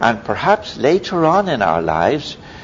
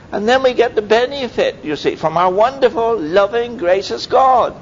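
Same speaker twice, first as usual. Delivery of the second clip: raised, fervent preacher's voice; fervent preaching; 10 semitones higher than usual. Acted. Preacher urging his flock to trust God's promises, fervent and raised. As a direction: lift the congregation, voice high.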